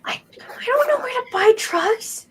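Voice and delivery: put-on very high, slow, strained child voice; flustered kid. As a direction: stammer out a denial as a kid. Game master plays the wary kid.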